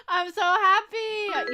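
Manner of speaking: high, loud and drawn out joy; thrilled. Real, not acted. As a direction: beam with happiness. Thrilled by the gift.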